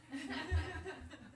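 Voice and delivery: sudden high soft giggle; soft high chuckle; high laugh. Real, not acted. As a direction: laugh lightly at the logic. Laughing at Shiva's logic.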